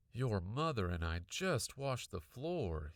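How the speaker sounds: usual speaking voice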